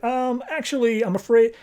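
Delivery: high, hesitant nervous voice; acted sheepish squeak; mock timid. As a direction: stall timidly with bad news. Acted reluctant answer to angry Brainiac.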